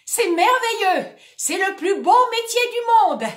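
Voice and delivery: high, loud forced cheer; shouting. Acted. Terrified actor shouting cheerfully to the audience. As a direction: shout it with fake joy over his fear. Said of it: "il crie".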